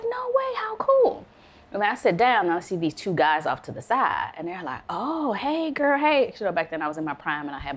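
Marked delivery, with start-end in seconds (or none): 0.0-1.2 very high-pitched delighted reaction; starstruck thrill. Retold or imitated. Friend reveals a celebrity link, high excited reaction. 4.9-6.3 imitating guys' high-pitched come-on; flirty greeting. Two guys greet her from the side, mock-flirty.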